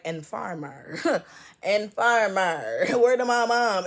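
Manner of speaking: loose imitation of a Jamaican movie line, slow and loud, laughing; mock Jamaican accent. Echoing a Belly line she kept hearing, in a laughing Jamaican accent. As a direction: quote it in a playful Jamaican accent, breaking into laughs. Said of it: "I kept hearing it in, like, that Jamaican accent".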